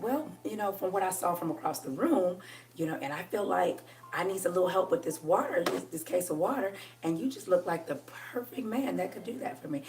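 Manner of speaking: soft, low, coaxing request; hushed flirtatious murmur. Shown as a demonstration. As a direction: keep it low and sweet, reeling him in. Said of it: "I'll lower my voice just like that".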